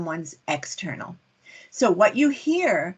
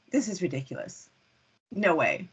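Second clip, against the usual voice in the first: dismissive; 3 semitones lower, 5 dB quieter than usual. Shown as a demonstration. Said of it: "what they mean is".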